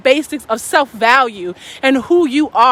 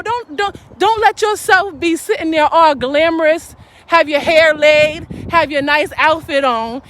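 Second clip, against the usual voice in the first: ordinary talk, nothing marked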